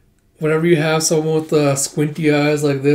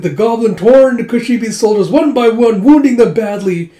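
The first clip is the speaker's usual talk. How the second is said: higher, louder dramatic storyteller; puppet show narrator; 8 semitones higher, 8 dB louder than usual. Acted. Puppeteer telling the townsfolk the battle, dramatic announcing. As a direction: announce it to a street crowd.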